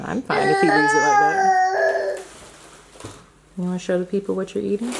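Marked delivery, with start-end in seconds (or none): 0.0-2.2 laughing. Real, not acted. Mother laughing about toddler's disinterest.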